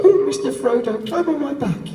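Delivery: high-pitched mock hobbit; hobbit impression. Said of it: "everyone else is like".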